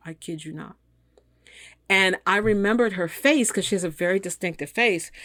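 usual speaking voice

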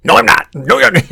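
high, loud mock protest; defensive father. Co-host imitating the dad denying involvement, defensive outburst. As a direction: imitate a dad refusing all blame, insistently.

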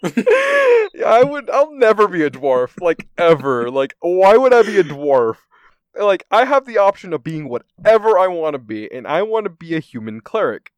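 loud, high, laughing outrage; indignant protest. Retold or imitated. Refusing the DM's dwarf rule, loud laughing protest. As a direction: protest loudly at the very idea. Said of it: "And I'm like".

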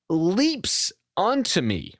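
normal talking voice

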